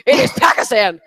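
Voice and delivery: loud jeering "ha ha ha" on a mocking laugh. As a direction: jeer at him with laughter. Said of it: "everyone was like".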